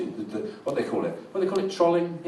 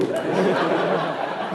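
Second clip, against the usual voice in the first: laughing; 5 semitones higher than usual. Real, not acted. Laughing at own joke.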